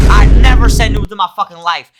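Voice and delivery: loud emphatic claim. Real, not acted. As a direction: shout it proudly. Streamer boasting loudly.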